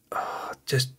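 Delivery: breathy under-the-breath whisper; whispered aside. Real, not acted. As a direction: whisper it to yourself in disbelief. Muttering to himself at the game.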